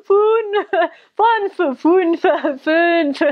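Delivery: fünf repeated high between giggles; laughing repetition of fünf; laughing through fünf. Real, not acted. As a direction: keep saying fünf while laughing. Retelling repeated word, laughing.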